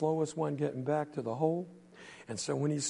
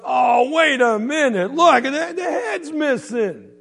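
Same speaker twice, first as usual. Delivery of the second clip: high, loud, slow indignant shout; loud protest; 10 semitones higher, 11 dB louder, slower than usual. Acted. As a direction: shout the complaint, dragging it out. Quentin protests loudly.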